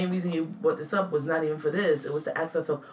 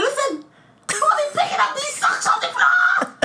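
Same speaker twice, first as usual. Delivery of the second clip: much higher, louder yell that breaks into laughter; mock exasperated yell; 5 dB louder than usual. Wife scolding new husband about socks, laughing mock yell. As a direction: go from zero to a thousand, half-laughing shout. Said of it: "It went from zero to a thousand".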